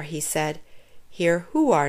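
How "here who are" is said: plain reading voice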